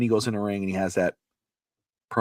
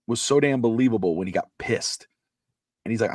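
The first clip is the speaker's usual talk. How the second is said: ordinary talk, nothing marked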